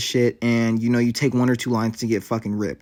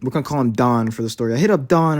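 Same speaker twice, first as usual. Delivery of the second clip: ordinary talk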